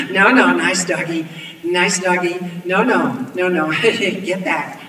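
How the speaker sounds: loud, nervous chuckling appeasement; nervous placating. A narrator's reading. Villain backing off from wolves, nervously placating. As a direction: fend off the animals with uneasy friendliness.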